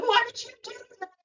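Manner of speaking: high, fast, startled yelp; shocked yelp. Retold or imitated. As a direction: squeal it right after the jab. Patient yelps after the shot.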